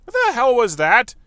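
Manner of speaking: loud, high disbelief; shocked outburst. Real, not acted. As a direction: blurt it out in shock. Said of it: "What a peculiar time".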